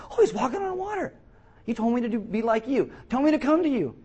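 high, loud, gushing eagerness; overexcited high-pitched Peter; excited disciple. Acted. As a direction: play an eager follower thrilled to copy his rabbi. Acts out excited Peter seeing Jesus.

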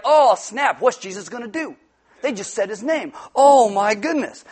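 hammed-up "oh my goodness" shock; mock astonishment. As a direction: play the scandalized bystander. Acts out astonished readers.

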